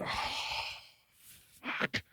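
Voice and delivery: barely voiced groaned curse; sinking dismay. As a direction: let it out under your breath, mortified. Learns the man is a comedian.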